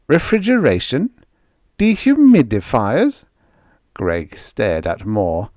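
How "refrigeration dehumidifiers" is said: high puzzled echo, louder; baffled double-take; incredulous. A narrator's reading. Greg echoes Moore in disbelief, incredulous.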